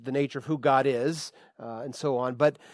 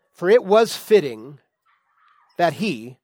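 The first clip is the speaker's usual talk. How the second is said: slow, louder formal scripture read; 3 semitones higher, 8 dB louder, slower than usual. Acted. Preacher reading the verse aloud to the church, solemn and emphatic. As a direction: lift the verse out for the congregation.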